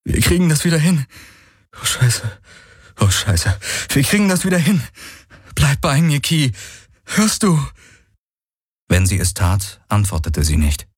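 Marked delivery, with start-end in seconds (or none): 0.1-7.6 high, desperate pleading; urgent reassurance breaking into distraught curses; tearful panic. A narrator's reading. Galen in tears to wounded Ki, pleading desperately. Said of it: "Tränen liefen".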